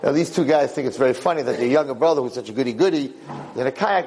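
ordinary talk

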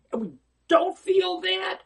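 usual speaking voice

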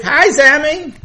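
put-on bright call up to a boy in the sky; calling up high. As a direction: shout a greeting upward, pitched high. Friend waves and calls up.

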